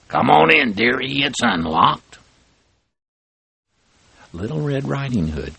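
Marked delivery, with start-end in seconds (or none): 0.1-2.2 loud, sly call from the bed; a wolf faking a sweet granny welcome; wolf posing as granny. Acted. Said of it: "The big bad wolf called out".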